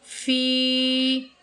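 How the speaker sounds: फ़ी held long, very slow; stretched recitation. Shown as a demonstration. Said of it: "खींच कर पढ़ना है". (फ़ी को लंबा खींच कर)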